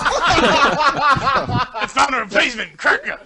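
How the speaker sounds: laughing mockery. Mocking the name, laughing.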